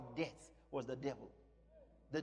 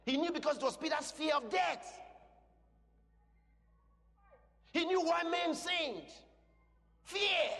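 high, emphatic pulpit cry; full-force preaching; 10 semitones higher, 3 dB louder than usual. Real, not acted. Preacher explaining why men sinned, full-force preaching. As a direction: hurl the answer out to the congregation.

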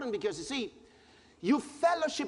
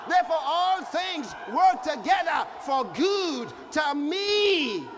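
preaching at full force; 5 semitones higher than usual. Acted. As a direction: build it up like a revival climax. Preacher building to shouted climax.

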